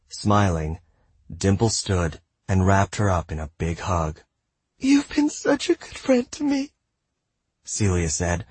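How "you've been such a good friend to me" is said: put-on a touch of a shaky girl's voice; high and shaky. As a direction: voice wobbling with tears held back. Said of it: "her voice high and shaky".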